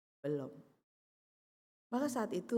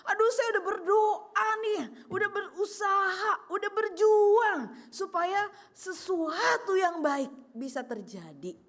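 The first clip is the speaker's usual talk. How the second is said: desperate lament; 9 semitones higher, 5 dB louder than usual. Retold or imitated. Voicing a desperate prayer.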